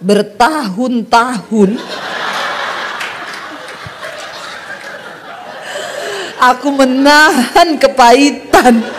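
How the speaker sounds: slow, drawn-out mock grievance; hammed-up bitter lament, very slow; long-suffering bitterness. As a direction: stretch it out like years of pent-up hurt. Retells husband's long-held bitterness.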